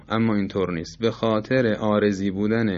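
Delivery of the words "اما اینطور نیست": usual speaking voice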